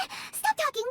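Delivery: high, panicked cry to stop; frantic interruption. Acted. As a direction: cut him off in alarm. Interrupts lecturing adept.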